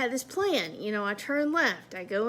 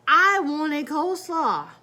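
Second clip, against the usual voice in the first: slow, louder stretched complaint; frustrated whine; 5 semitones higher, 9 dB louder, slower than usual. Retold or imitated. Complaining to herself about wanting coleslaw, slow loud whine. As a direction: drag it out in exasperation.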